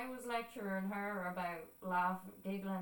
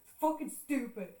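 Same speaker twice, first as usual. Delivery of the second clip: higher, louder retold grumble; imitated upset outburst; upset dismissal; 4 semitones higher, 5 dB louder than usual. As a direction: write it off in a mock huff. Retelling giving up while crying.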